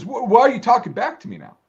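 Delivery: high, loud, offended demand; offended snap. Retold or imitated. Sergeant rebuking the soldier, high offended retort. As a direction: snap at him for talking back. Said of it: "He goes".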